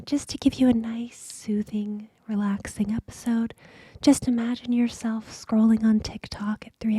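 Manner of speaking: soothing whisper. Acted. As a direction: lull the listener with a whisper. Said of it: "keep it these, uh, A S M R voices".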